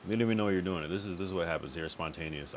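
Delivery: ordinary talk, nothing marked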